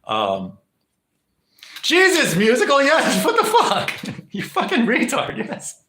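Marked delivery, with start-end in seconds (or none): 1.8-5.8 laughing. Real, not acted. Streamer cracking up at chat.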